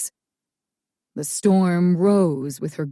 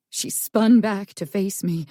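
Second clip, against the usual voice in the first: plain reading voice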